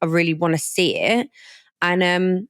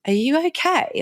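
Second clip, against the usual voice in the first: startled concern; 9 semitones higher than usual. Retold or imitated. Friend startled at hungover host.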